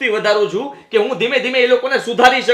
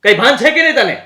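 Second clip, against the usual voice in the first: raised, higher voice, snapping back at her; angry outburst; 4 semitones higher, 5 dB louder than usual. Acted. Husband yelling at Meera over her accusation, angry. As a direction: shout her down in disbelief.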